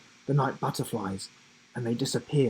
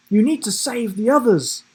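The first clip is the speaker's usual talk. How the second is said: high, loud pleading urge; urging; 10 semitones higher, 7 dB louder than usual. A narrator's reading. Giraffe urging the children to save the others. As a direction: press them to act now. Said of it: "urged Sam".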